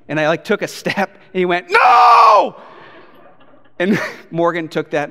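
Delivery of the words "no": very loud, high drawn-out shriek; toddler's scream. Retold or imitated. Two-year-old seeing dad step toward the candy, full scream. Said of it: "Like, he screamed".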